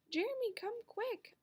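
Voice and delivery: high urgent call; calling for help. A narrator's reading. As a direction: shout it down the stairs. Girl calls for help.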